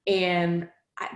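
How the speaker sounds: ordinary talk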